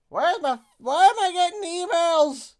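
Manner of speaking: high, loud exasperated wail; exasperated outcry. Real, not acted. Streamer interrupted by emails, high exasperated outcry. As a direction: cry out in exasperated disbelief. Irritated reaction to emails popping up.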